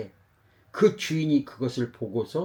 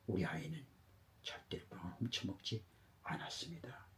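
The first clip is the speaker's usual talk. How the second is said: very soft, lowered voice; quiet solemn conviction; 4 semitones lower, 15 dB quieter than usual. Acted. Father vouches for his son, quietly solemn. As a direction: declare your trust almost under the breath.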